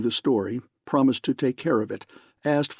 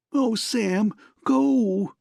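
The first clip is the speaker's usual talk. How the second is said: high, breaking wail; much higher, cracked plea; thin wailing; 10 semitones higher, much slower than usual. A narrator's reading. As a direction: voice breaking as she begs. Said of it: "broke, and was thin and wailing".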